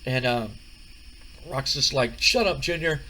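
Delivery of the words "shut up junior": louder dismissive put-down; The Rock, dismissive. Retold or imitated. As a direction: brush him off.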